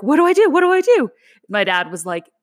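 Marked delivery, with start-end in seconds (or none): 0.0-1.1 high, loud frantic repeat; panicked. Retold or imitated. Asking dad in high loud panic. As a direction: squeal it like you're losing it. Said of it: "I was like".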